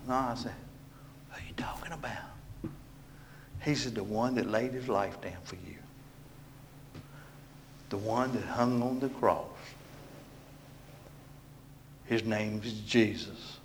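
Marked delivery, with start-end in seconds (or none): 1.3-2.7 whispered, acted question; hushed question. Hushed question repeated to the Spirit. 3.9-13.6 soft, low voice of the Spirit; small, still voice. Acted. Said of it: "Does it in that small, still voice".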